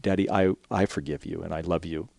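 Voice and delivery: barely above a murmur, loving; a hint of a young girl forgiving; soft forgiveness. Daughter forgives her father.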